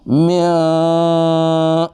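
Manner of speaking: میاں hummed through the nose, long and high; nasal tajweed sound; nasal ghunna. Shown as a demonstration. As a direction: hold the nasal hum one alif. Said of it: "ناک میں آواز".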